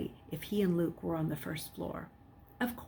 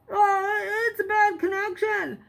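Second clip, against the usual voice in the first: much higher, louder; speaking louder; 13 semitones higher, 4 dB louder than usual. A narrator's reading. Boy posing as Jason, speaking louder on the phone. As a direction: raise the voice for the phone. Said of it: "speaking louder".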